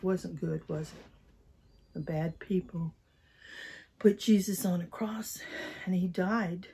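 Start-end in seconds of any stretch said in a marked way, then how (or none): 1.9-6.7 whispered sad telling; hushed. Acted. Teacher whispering the sad story to children. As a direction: drop to a whisper for the sad part.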